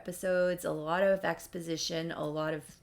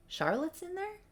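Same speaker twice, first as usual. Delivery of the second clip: surprised; 12 semitones higher, 3 dB quieter than usual. Retold or imitated. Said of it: "He's like".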